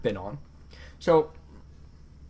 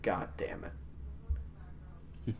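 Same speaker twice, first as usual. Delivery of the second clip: muttered curse; 6 semitones lower, 17 dB quieter than usual. Retold or imitated. Retold annoyance at the dog.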